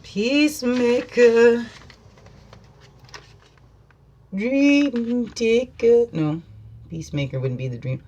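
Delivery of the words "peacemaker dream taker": slow, higher drawn-out intoning; mystical intoning. Acted. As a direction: intone it slowly like a spell.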